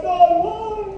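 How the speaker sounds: high pleading cry to God; a mock anguished plea heavenward. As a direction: imitate a despairing plea. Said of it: "I'm crying".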